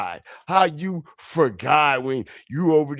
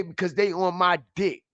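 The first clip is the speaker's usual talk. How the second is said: ordinary talk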